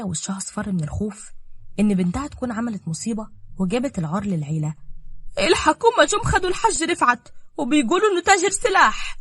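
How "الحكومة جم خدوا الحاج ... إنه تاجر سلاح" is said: higher, urgent worried voice; breathless with bad news; anxious daughter. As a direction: daughter panicking on the phone. Daughter reports arrest.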